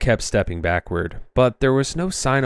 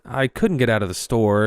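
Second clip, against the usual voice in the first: ordinary delivery, nothing marked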